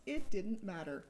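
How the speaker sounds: normal talking voice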